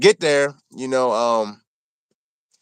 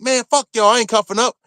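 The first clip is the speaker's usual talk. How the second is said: higher, defiant retold outburst; defiant anger; 6 semitones higher than usual. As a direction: throw it back at the guards, mock-angry. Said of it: "I'm like".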